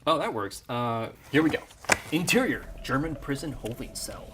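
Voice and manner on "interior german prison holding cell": story narration. A narrator's reading. Screenplay narration over music.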